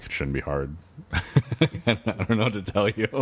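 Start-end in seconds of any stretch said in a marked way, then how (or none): 0.9-3.2 talking through a laugh; real laughing. Real, not acted. Answering the friend who wants fried bell pepper, laughing. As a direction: laugh your way through the words.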